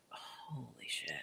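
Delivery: slow, hushed whisper of shock; barely audible, awestruck whisper; stunned whisper. Retold or imitated. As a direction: whisper it drawn out, as if caught out. Retold stunned reaction to grandmother.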